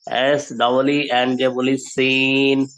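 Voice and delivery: ordinary talk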